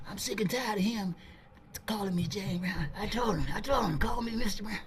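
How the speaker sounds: soft, high grumble of irritation; fuming hushed complaint. Retold or imitated. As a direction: complain under your breath, fuming. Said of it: "he was backstage fuming".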